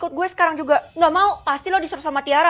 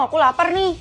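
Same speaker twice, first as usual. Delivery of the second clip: ordinary delivery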